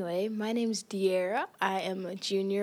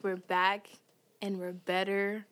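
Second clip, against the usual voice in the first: ordinary delivery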